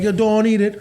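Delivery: high-pitched, thick Cork lilt; exaggerated Cork sing-song; strong Cork accent. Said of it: "their accent is so strong".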